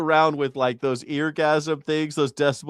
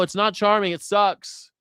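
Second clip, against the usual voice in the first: ordinary talk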